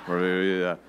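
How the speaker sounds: low, mumbled single word; mumbling. Shown as a demonstration. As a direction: mumble it like a shy crowd. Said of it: "You all sound like this".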